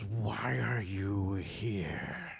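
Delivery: slow, hissing goblin question. Acted. Robed goblin confronting the party, slow hissing voice. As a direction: hiss it, quiet yet full. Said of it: "in a hissing voice, which is almost quiet and yet voluminous".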